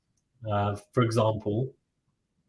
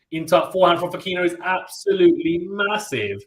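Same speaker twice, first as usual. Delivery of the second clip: higher-pitched call of a big shot; excited play-by-play; 5 semitones higher than usual. Real, not acted. Commentator hails a forehand from Fokina, excited. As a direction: let the rally's finish lift the call.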